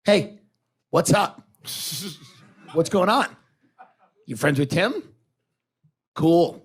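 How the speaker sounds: mimicked cool greeting with long pauses; mock attitude. Comic imitates young comedians greeting a newcomer, with attitude. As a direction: dole out each short greeting with guarded cool. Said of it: "Here's the attitude. They go, they go like this".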